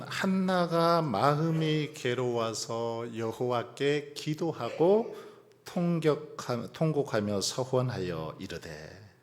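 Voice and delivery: loud, slow reading, drawing out each phrase; scripture reading. Acted. Preacher reading Hannah's story to the congregation, slow scripture reading. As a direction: read the verse out strongly and deliberately.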